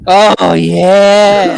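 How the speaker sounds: high loud breathy oh yeah; mock seductive moan. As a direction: moan it theatrically.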